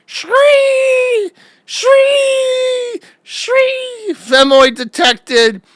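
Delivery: high, piercing shriek of an alarm; shrieking yell. Retold or imitated. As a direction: screech it out like a crazed zealot. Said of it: "and he's like".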